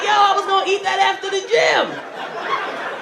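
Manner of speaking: mock outrage. Mock outrage over lost snacks.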